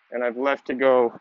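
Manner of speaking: normal talking voice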